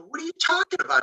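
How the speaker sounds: high-pitched, quick, indignant question; retold furious protest. Pitcher to the coach pulling him from the game, retold indignant protest. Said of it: "he is just furious with Leo".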